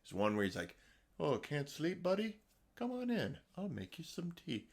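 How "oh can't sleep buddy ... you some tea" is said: high and soft, gently inviting a sleepless friend in; soothing cabin host. Retold or imitated. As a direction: mimic a kindly woodsman offering tea, much softer. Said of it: "it's just this very soothing voice".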